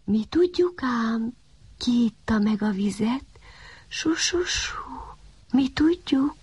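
secretive whisper from behind; mysterious whisper. A narrator's reading. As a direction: tease him in a hushed hiss. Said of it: "hallatszott hirtelen a háta mögül".

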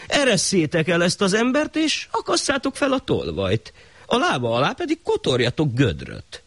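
very high royal order; the shah issuing a new decree; high pompous command. Acted. Shah changes his order.

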